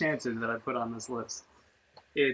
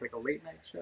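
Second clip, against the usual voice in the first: ordinary talk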